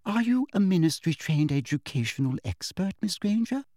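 high, sugary and condescending; teacher's fake-sweet put-down; falsely sweet. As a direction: sweetness that doesn't mean it. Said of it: "in her falsely sweet voice".

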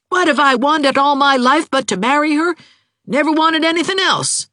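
very high, loud outburst; exasperated cry. A narrator's reading. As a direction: blurt it out at the end of patience. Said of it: "cried Jim in exasperation".

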